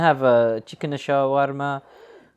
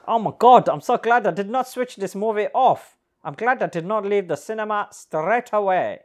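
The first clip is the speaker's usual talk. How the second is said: much higher, loud thrilled outburst; excited; 9 semitones higher, 7 dB louder than usual. Real, not acted. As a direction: burst out with delight. Thrilled at extra scene.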